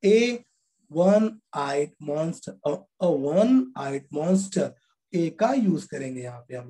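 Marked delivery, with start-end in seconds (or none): 0.9-2.7 वन आइड monster read slowly; slow demo reading. Shown as a demonstration. Teacher slowly reading an example for students. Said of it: "अ बोलेंगे". 2.7-4.7 अ वन आइड monster read slowly; slow demo with article. Shown as a demonstration. Teacher slowly repeating the example with its article. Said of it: "अ बोलेंगे".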